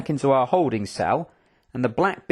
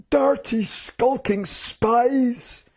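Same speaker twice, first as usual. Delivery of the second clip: high, very drawn-out scornful accusation; contemptuous cry; 10 semitones higher, much slower than usual. Acted. General accusing the two he has caught, contemptuous. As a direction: stretch each insult with disgust. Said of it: "he cried in a tone of contempt".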